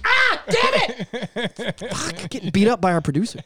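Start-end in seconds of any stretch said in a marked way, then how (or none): 0.1-1.8 high, slow mock cry of pain; mock pained yelp. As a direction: yelp like being hit. Getting beat up by producer.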